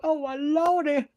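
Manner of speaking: high, mock-fretful pearl-clutching voice; high whiny mock lament; mocking pearl-clutcher. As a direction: ham up a fussy pearl-clutcher. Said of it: "oh, clutching your pearls".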